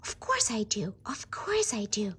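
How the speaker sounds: ordinary talk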